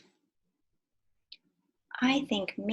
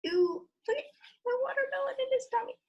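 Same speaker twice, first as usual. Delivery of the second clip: high excited ooh; faster than usual. Acted. Excited picture book reading.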